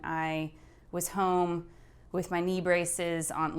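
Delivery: ordinary talk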